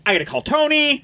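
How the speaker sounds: high worked-up outburst; instant rage. Retold or imitated. Insulted by his friend, he flies into worked-up fury.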